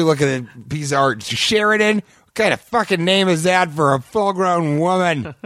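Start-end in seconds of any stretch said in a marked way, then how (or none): 1.2-5.3 high, slow sneering artist voice; mock her name with a pinched high whine; angry caricature artist. Caricature artist sneering at a woman customer's name.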